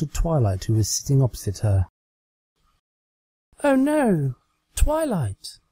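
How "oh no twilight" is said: much higher, hammed-up theatrical gasp; overly dramatic. Trixie playing pretend with her doll, much higher and overly dramatic. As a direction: play it like a melodrama heroine. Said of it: "in an overly dramatic voice".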